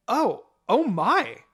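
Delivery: high, loud, slow gasp of surprise; delighted shock at the baby; surprised. A narrator's reading. Mother seeing Makoto's baby, loudly surprised. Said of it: "my mother said surprisingly".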